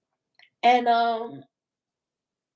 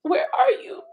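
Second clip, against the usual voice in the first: frantic calling; 8 semitones higher than usual. Retold or imitated. Friends calling her after the shooting, frantic yelling. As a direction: yell for her like you're scared. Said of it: "they was just like".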